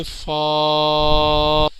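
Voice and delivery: فا with a long level vowel; drawn-out letter. Shown as a demonstration. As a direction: hold the vowel of فا steadily. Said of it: "کھینچ کے پڑھیں گے".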